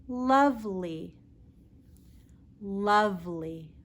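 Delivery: lovely, said slowly for repeating; teacher repeating lovely; modelled L word. Shown as a demonstration. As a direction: give lovely a careful L. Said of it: "Practice with me".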